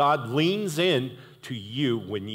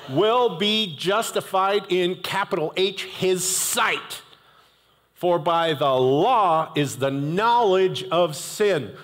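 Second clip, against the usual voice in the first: raised, slow scripture read; 5 semitones higher, slower than usual. Acted. Preacher reading scripture aloud to the congregation, raised and slow.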